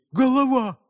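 high-pitched warning calls; alarmed cry. A narrator's reading. Lads recognise the village head, high alarmed cries. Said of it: "закричали хлопцы".

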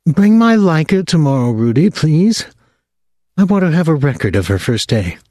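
a strong voice from the hospital bed, clear and insistent; surprisingly strong voice. A narrator's reading. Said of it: "in a surprisingly strong voice".

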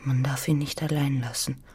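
soft whispered echo. Acted. Anna whispering Jette's words back.

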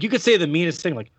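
ordinary talk, nothing marked

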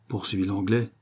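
ordinary delivery

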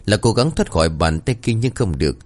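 plain reading voice

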